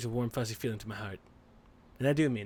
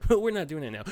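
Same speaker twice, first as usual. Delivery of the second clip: ordinary talk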